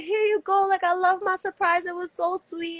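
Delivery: high, sweet thank-you voice; high, gushing thanks to the maid; grateful. Retold or imitated. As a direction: gush a little as you hand over the tip. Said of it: "I was like".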